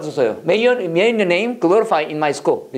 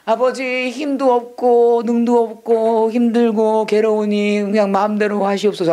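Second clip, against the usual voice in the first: higher, slow droning plea on held syllables; mock weary prayer; 3 semitones higher, slower than usual. Teacher imitating people praying with no strength, mock labored drone. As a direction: whine it out like a burdened, defeated believer. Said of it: "이렇게 힘들게 기도하는 사람들".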